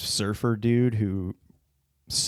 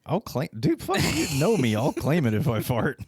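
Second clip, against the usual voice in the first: indignant protest; 5 semitones higher, 3 dB louder than usual. Real, not acted. Protests he didn't fart.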